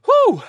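loud high exhale of relief; relieved whew. Acted. Grayson gulping champagne lets out a loud relieved whew. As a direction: blow out a big relieved whew.

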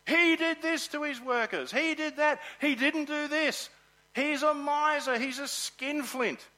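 high, loud, indignant ranting; hammed-up gossiping complaint; mock online outrage. Preacher mimicking social media critics, loud high indignant rant. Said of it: "We would be hearing about this landowner and the unfairness".

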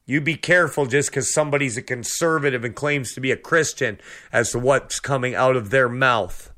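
ordinary delivery

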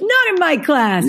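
put-on strict little coach scolding in a high Eastern European accent; high-pitched mock reprimand, put-on Eastern European accent; mimicked Eastern European accent. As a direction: lay down the law like an Eastern European trainer. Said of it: "I can't do the accent".

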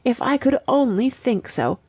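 high, mock-plaintive repetition; gulping. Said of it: "with a gulp".

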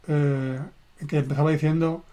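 ordinary delivery

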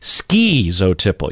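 "Schizotypal" opening with long skee; with ski. Shown as a demonstration. Teacher echoing the ski version. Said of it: "You hear that ski in there".

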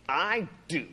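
mock back-talk, drawn out; sassy. Jonah talking back to God, sassy. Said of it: "he's sassing God".

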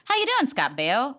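awkward greeting. Retold or imitated. Making small talk with a stranger on the couch, awkward greeting. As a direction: lift the pitch on an awkward hello. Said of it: "I said his first and last name like that".

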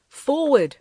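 loud, high-pitched urgent command; agonized cry. A narrator's reading. Pignone orders the party to Aniwi's rescue, agonized. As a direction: cry out, rallying the party in anguish. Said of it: "exclaimed Pignone in an agonized voice".

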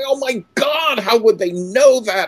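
high, excited outburst of wonder; thrilled gasp. Retold or imitated. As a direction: gush like an amazed kid discovering it. Boy thrilled by the book.

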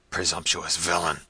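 a touch of contempt in the outburst; cried out angrily. A narrator's reading. Prince scorning the peasant, mildly angry. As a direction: scorn him. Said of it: "cried Manfred".

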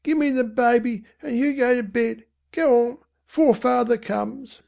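high-pitched Cockney mother; earnest mother. Acted. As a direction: send the child to bed in a high voice. Voices mother sending boy to bed.

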